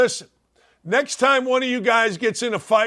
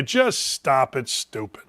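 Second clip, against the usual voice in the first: ordinary talk, nothing marked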